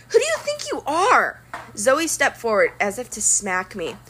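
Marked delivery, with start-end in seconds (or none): 0.1-1.4 high, indignant demand; angry challenge. A narrator's reading. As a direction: put him in his place. Zoe confronts Percy.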